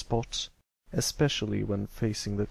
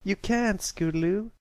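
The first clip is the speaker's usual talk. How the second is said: put-on straining young filly; 10 semitones higher than usual. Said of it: "struggling to keep a tight hold".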